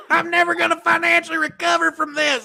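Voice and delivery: high, laughing mock-despair quote; Tiger King quote. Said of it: "You Tiger King it".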